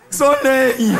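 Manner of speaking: a hint of a dim-witted lad; low mock son voice. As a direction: imitate the son's one word. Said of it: "ce qu'il dit maintenant".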